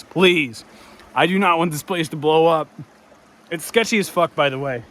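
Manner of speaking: ordinary talk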